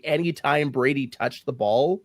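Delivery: ordinary talk, nothing marked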